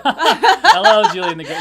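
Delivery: loud laughing hello; laughing greeting. Real, not acted. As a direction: burst out laughing while greeting.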